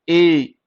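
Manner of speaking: demonstrating long ए to students. Shown as a demonstration. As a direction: draw out the ए. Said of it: "ये long ए है।".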